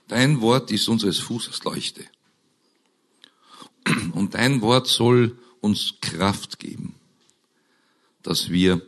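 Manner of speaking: usual speaking voice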